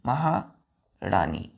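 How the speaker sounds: slow teacher's model of महारानी; drawn out slowly. Shown as a demonstration. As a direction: stretch every syllable of महारानी very slowly. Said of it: "क्या होता है बच्चों?".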